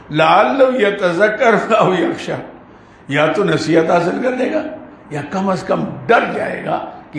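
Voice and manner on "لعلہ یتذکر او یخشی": slow Arabic verse recitation; Quran recitation. Real, not acted. Preacher quotes the verse on gentle preaching, slow recitation. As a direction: stretch the verse out measuredly.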